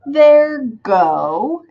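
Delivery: "there" lifted high, then "go" as the next beat; rising scansion beat. Shown as a demonstration. Teacher showing how the sound rises, "there go" in separate beats. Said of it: "raise up when I say there".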